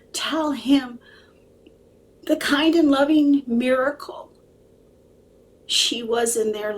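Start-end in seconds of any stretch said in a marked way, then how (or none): none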